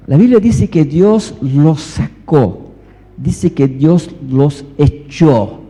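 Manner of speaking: slow, with "sacó" and "echó" stretched and punched; emphatic preaching. Real, not acted. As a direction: drive home "sacó" and "echó". Preacher drives home the two verbs.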